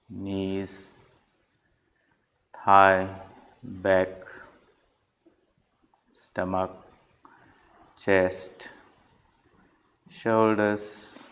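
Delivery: measured, even instructions with long pauses; unhurried meditation leader, leaving space after each phrase; guided meditation. Real, not acted. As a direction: guide them through the body, a few words at a time.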